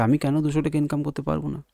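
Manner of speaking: ordinary talk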